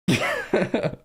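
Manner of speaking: high burst of real laughter; helpless laugh. Real, not acted. Real laughing fit at crude joke.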